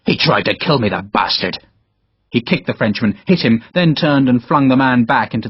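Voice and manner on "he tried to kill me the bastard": low, seething complaint; mild fury. Acted. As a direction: Harper rages at the man who attacked him. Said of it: "The Irishman was in a fury".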